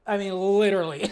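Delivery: exasperated loud "I mean, literally"; emphatic literally. Real, not acted. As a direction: lean hard on "literally".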